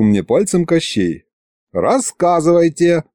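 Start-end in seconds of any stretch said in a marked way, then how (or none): 1.7-3.0 higher-pitched, mildly stern command; strict order. A narrator's reading. Wife demanding the truth from sorcerers, strict. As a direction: order them to explain, a touch stern. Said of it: "строго приказала Варя".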